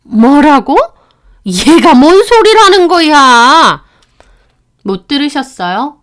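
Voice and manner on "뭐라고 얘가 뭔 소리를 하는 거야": louder, higher, slowed in disbelief; stunned mother-in-law. Acted. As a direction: demand what she means. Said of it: "갑자기 조용해지더라고요". (어리둥절해 되묻는 시어머니)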